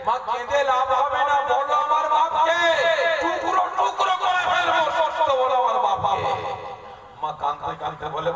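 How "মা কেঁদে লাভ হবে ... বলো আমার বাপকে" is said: high, forceful insistence; threatening demand. Acted. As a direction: demand it hotly. Son threatening mother. (চড়া গলায় হুমকি)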